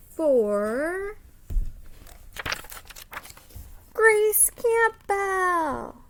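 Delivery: triumphant slow announcer call; dramatic announcement. A narrator's reading. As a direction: stretch the name out slowly like a grand reveal. Dramatic final vote announcement.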